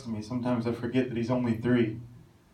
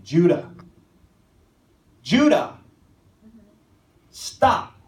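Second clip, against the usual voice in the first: urgent shout down the street; retold shout; 6 semitones higher, 7 dB louder, slower than usual. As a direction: call out loud and higher, a father shouting after his child. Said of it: "I shout his name".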